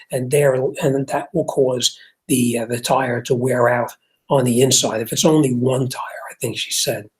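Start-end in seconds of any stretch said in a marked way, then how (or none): 6.3-7.1 whispered aside. Real, not acted. Muttered aside to himself.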